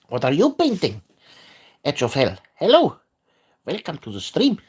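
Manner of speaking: playful, exaggerated sing-song with big pitch swings; silly mock voice. Streamer greeting viewers about painting horses, in a silly hammed-up voice. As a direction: ham up every phrase for fun. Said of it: "Why I'm talking like this".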